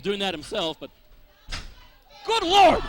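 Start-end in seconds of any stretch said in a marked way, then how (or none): 2.3-2.8 sudden high loud exclamation; surprised. Real, not acted. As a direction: blurt it out in shock. Commentator reacts to a big move.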